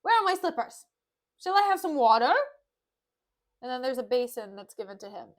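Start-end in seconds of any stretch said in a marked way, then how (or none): 0.0-2.3 high, loud impatient questions; shouted demands. Acted. Petruchio demanding slippers and water, shouting. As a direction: holler at the staff theatrically.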